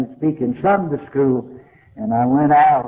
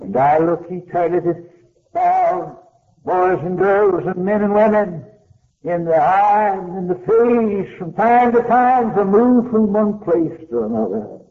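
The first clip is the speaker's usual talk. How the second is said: higher, rising preacher's call, a touch of fervor; fervent preaching; 6 semitones higher than usual. Real, not acted. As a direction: lift the voice as you sweep the congregation. Preacher building to climax.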